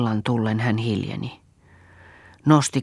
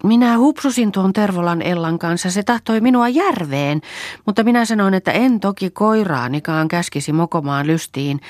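high, agitated tirade; worked-up, higher-pitched rambling; feverish, heated; 5 semitones higher, 4 dB louder than usual. A narrator's reading. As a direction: rattle on indignantly in a raised voice. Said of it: "puhui kiihkeästi".

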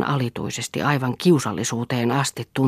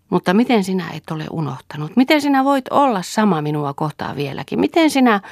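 acted emotional outburst, rising higher and higher; impassioned questioning; 6 semitones higher than usual. A narrator's reading. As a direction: let disbelief climb with each question. Overwhelmed questioning of devoted lover.

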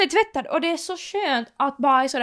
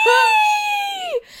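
sudden high mock yell of despair; mock outraged shout. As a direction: yell at the fallen coat in hammed-up horror. Coat falls, mock outrage. (låtsat upprört skrik)

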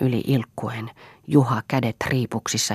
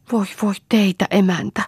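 high, plaintive wail; 9 semitones higher, 3 dB louder than usual. Acted. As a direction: moan it, begging her to stop. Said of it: "vaikeroi Kaisa".

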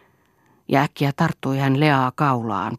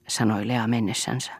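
usual speaking voice

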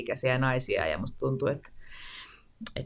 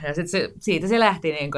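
ordinary talk

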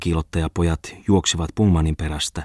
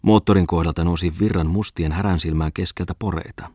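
usual speaking voice

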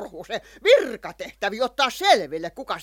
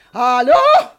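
much higher, louder hello into the phone; 9 semitones higher, 11 dB louder than usual. Acted. Hän soittaa poliisille, loud high phone greeting. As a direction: call down the line.

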